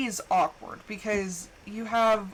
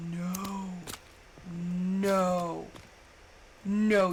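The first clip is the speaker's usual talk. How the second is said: ordinary talk, nothing marked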